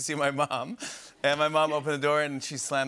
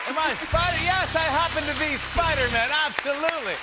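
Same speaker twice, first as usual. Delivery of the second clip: high, loud, gushing yes to Spider-Man; excited yes; 13 semitones higher, 4 dB louder than usual. Pretend. Host playing Spider-Man answering the guard, thrilled and loud. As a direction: burst out like a thrilled superhero.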